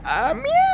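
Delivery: high squeaky kitten meow; cat meow. Acted. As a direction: mew like a small frightened cat. (ऊँची पतली आवाज़ में बिल्ली की म्याऊँ)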